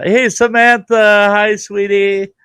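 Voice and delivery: high, slow and sing-song hello; lifted, lingering hello to a visitor; sweet greeting. Real, not acted. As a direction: greet her fondly, drawn out. Greeting someone who walks in.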